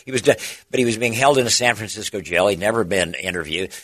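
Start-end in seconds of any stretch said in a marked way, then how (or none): none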